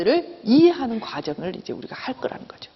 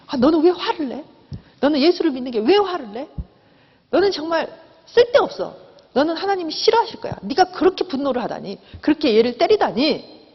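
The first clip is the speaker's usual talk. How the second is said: higher-pitched acted scolding; self-reproach; 6 semitones higher than usual. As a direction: take yourself to task, voice raised in pitch. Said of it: "우리는 그렇게 우리 자신을 추궁해요". (높은 목소리로 스스로를 추궁하듯)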